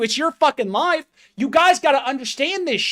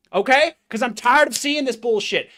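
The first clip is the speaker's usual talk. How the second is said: ordinary talk, nothing marked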